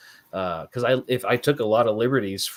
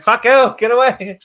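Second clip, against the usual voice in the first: joking shout; 12 semitones higher, 9 dB louder than usual. Retold or imitated. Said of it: "It's like".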